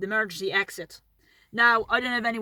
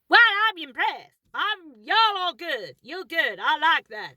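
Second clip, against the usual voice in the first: high, singsong American stewardess voice; 5 semitones higher, 3 dB louder than usual. Retold or imitated. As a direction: gush like an impressed American flight attendant. Imitating American flight attendant's reply.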